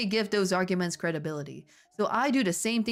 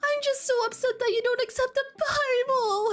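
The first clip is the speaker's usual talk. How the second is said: high put-on sobbing voice; mock sob. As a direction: whimper it like a hammed-up crybaby. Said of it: "Hermione sobbed femininely".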